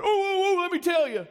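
high, loud eager blurting. Retold or imitated. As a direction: burst in wanting to talk first. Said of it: "he'd have been right there going".

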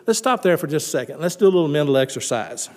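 ordinary talk, nothing marked

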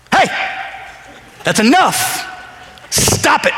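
sudden sharp parental rebuke; dad voice. Retold or imitated. Dad finally rebuking his arguing kids, stern dad voice. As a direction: cut them off, each command on its own. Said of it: "you do have a dad voice".

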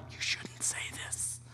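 slow stunned whisper; aghast hushed aside; shocked whisper. Retold or imitated. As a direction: whisper it, jaw dropped. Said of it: "I'm sitting there with my jaw on the ground like".

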